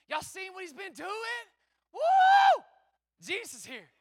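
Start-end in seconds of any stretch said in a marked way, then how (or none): 1.9-2.6 high loud woo; excited whoop. Real, not acted. Pastor whooping with the crowd, joyful loud whoop. Preacher's exuberant cry.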